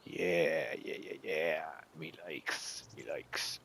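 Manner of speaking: low, soft goofy me likes; silly pleased voice. Pretend. Player pleased with the terrain, low goofy voice. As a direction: put on a dopey satisfied grumble.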